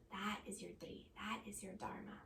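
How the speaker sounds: hushed, breathy whisper. Real, not acted. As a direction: let the words drop to a whisper. Soft emphasis on core teaching.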